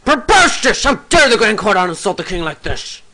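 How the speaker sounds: high, loud, indignant protest; acted outrage. Assembly member objecting to an insult to the king, outraged. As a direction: cry out in theatrical indignation.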